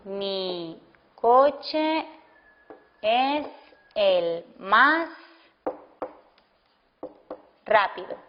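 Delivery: word by word, with long gaps between words; slow dictation. Shown as a demonstration. Teacher to class, dictating an example sentence slowly. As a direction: dictate it slowly so students can write.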